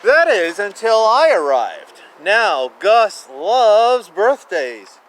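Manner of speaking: loud, slow dramatic storytelling; dramatic reading. A narrator's reading. Parent reading to child, loud dramatic build. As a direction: build up the twist big.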